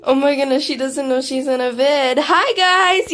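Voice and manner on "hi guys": high, loud hello; excited greeting. Real, not acted. Player greeting viewers in a video, high excited call.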